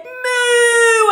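long, high, shouted moo; cow moo. A narrator's reading. Cow celebrating the catch, loud long mooing. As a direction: moo at full volume. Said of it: "the cow said".

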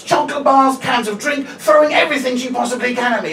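usual speaking voice

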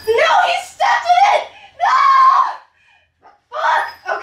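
high shouted no in dismay. Real, not acted. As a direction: yell as the puppy walks through the mess. Puppy stepped in poo.

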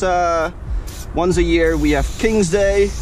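usual speaking voice

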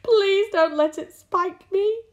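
high, loud, slow pleading; frightened begging. A narrator's reading. Earthworm pleading not to be spiked, begging. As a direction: beg in a drawn-out, high wail. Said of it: "begged the earthworm".

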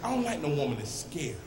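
usual speaking voice

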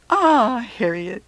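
big, high, loud "Ah" easing off; heartfelt cry to a dear friend; fond exclamation. Acted. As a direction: exclaim with great feeling, then settle. Wiz's fond farewell declaration.

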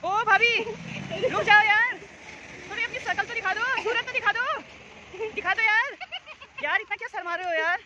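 high, loud teasing call to someone walking off. Real, not acted. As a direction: shout after her to stop and show her face. Calling out to shy neighbour. (ऊँची आवाज़ में पुकारते हुए)

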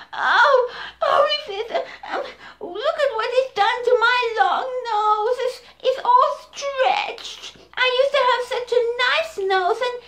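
high, sobbing elephant voice; crying little elephant. Acted. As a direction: wail over the stretched nose. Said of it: "And he cried and he cried".

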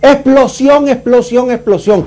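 shouting alarm, rising then fading; grito imitado. Retold or imitated. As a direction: call out the warning in alarm. (grito de alarma imitado)

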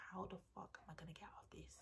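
fast, very soft whisper; panicked whisper. Retold or imitated. Trapped on the date, fast whispered panic. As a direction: hiss the panic under your breath.

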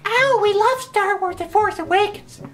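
mocking fans. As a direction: sneer at fans by gushing. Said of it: "people are just like".

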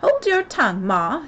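high, loud snappy retort; peevish young crab; snappish. A narrator's reading. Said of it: "said the young crab a little snappishly".

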